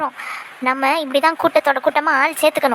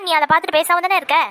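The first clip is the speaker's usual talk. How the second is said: ordinary talk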